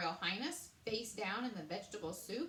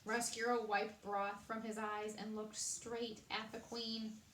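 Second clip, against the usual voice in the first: ordinary delivery, nothing marked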